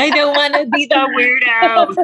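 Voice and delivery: hammed-up pleading; mock whine. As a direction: whine it playfully, pitch held up. Voices the fear of standing out.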